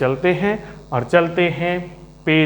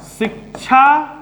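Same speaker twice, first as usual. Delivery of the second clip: शिक्षाप्रद split as शिक्षा, pause, प्रद, pitched high; शिक्षाप्रद raised and carefully separated; syllable-by-syllable; 7 semitones higher than usual. Shown as a demonstration. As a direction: break शिक्षाप्रद in two for learners. Teacher spells out key word slowly.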